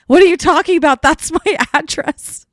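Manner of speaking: high, loud, laughing disbelief; baffled disbelief. Retold or imitated. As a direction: protest in amused shock. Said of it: "I'm like".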